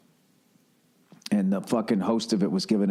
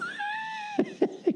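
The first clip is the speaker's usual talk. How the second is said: high shrieking laugh; 5 dB quieter than usual. Real, not acted. Laugh after mock question.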